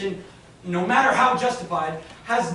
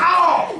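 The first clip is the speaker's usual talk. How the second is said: pained yelp; 7 semitones higher, 7 dB louder than usual. Acted. She grabs his hand.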